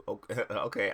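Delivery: plain reading voice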